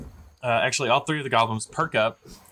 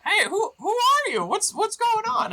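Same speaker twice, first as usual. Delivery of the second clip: very high, stammered goblin challenge; startled goblin. Acted. Goblin answering a voice from the bushes, high startled voice. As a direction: squeal the question out like a confused goblin.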